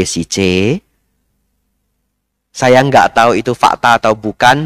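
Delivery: usual speaking voice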